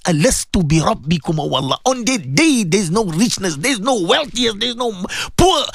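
ordinary talk

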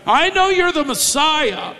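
high, pointed claim; demon's admission. Shown as a demonstration. Demon naming Jesus as Messiah, high and pointed. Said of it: "the Holy One and the Messiah".

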